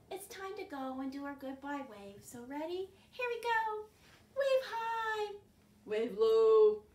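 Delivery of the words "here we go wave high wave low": usual speaking voice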